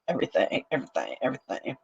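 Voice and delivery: a breathy whispered list dying away; fading whisper. Real, not acted. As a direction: let it trail off to a whisper. Trailing repeated word.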